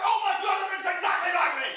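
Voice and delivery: hammed-up surprise; mock amazement. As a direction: gasp it out as if seeing it at last. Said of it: "I'd be like".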